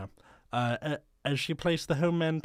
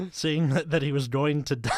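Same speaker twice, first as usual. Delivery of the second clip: ordinary talk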